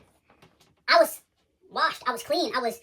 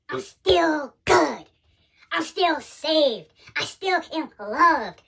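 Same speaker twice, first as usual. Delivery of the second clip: slow emphatic declaration; 3 dB louder, much slower than usual. Real, not acted. Testimony climax, each word drawn out.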